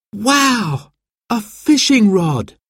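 put-on high, thrilled boy's cry of delight; young boy bursting with excitement; excited exclaim. A narrator's reading. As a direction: gasp it out as if you can't believe it. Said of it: "exclaimed Jack".

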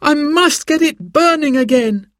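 put-on high, worried boy's cry; anxious urgency. A narrator's reading. Simon must relight the fire, urgent and worried. Boy panics over dead fire.

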